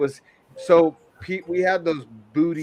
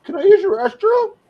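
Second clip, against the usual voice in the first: very high, loud mock-innocent ask; imitating the coworker; 8 dB louder than usual. Coworker asking customers for restroom, squeaky high mock voice. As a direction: squeak it out like a guileless kid. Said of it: "He's like".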